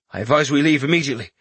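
a lightly voiced soldier, brisk and uneasy; the worried captain hurrying his caution; wary captain. Captain warning his lord, lightly voiced and brisk.

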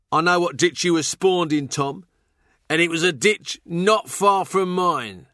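high-pitched, mocking jester; goading fool's voice, pitched well up; taunting fool. A narrator's reading. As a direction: needle him in a high, jeering voice.

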